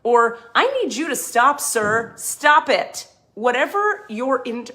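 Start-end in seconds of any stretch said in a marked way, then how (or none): 0.5-3.1 louder, higher firm stop it; imitating telling a man firmly to stop. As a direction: set a hard boundary with your voice. Firm boundary quote.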